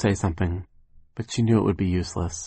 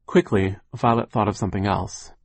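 usual speaking voice

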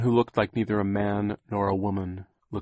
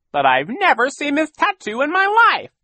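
much higher, louder villain voice; villain's indignant denial; 11 dB louder than usual. Acted. Olaf denies knowing the tattoo, indignant. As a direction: protest innocence loudly, pitched way up.

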